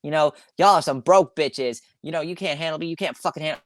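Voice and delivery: mock-taunting broke listeners in a loose kid voice; bratty kid flexing. Podcaster mocking the young influencer's bragging videos, lightly imitated kid voice. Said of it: "partaking in flex culture and being like".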